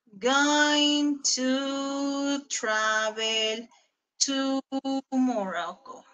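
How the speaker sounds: drawn out, each word stretched; slow dictation. Shown as a demonstration. Teacher dictating the rest of the sentence to the class, very slow. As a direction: dictate it slowly so they can copy. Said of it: "y copiamos todo lo demás".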